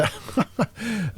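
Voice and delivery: a short, breathy laugh at something that isn't funny; helpless little laugh; rueful laugh. Real, not acted. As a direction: laugh in disbelief at the damage. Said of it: "don't know why I'm laughing here".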